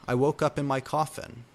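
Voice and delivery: usual speaking voice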